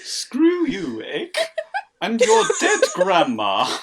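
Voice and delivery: high, drawn-out snooty jerk; posh bastard voice. Acted. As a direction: sneer it like a spoiled rich kid. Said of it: "we picked the right voice".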